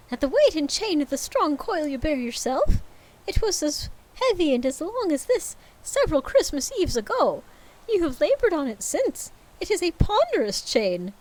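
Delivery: high, hammed-up ghost voice; a wailing ghost warning Scrooge; ghost of Marley. Marley's ghost warns Scrooge in high hammed-up voice.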